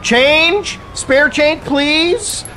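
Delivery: high, thin begging voice; pleading beggar. Retold or imitated. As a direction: plead for spare change like a street beggar. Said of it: "It's literally like fucking".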